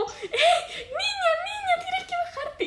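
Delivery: high, slow mock yell at the girl; imitated crowd shouting, half laughing; passengers yelling. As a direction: call out like a whole bus urging her off. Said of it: "todos los de la micro diciendo como".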